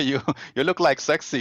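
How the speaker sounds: put-on speaking in an Indian accent.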